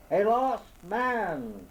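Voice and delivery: slow, high calling shout. A narrator's reading. Lost man shouting for help across the woods, high and drawn out. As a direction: call out into the dark woods. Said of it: "I shouted back at the top of my voice".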